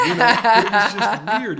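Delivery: real laugh through the words. Real, not acted.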